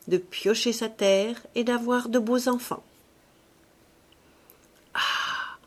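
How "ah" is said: soft, voiceless exhalation; soft sigh. A narrator's reading. An older husband longing for a child, softly. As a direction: breathe out a gentle, wistful "ah". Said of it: "reprit-il doucement".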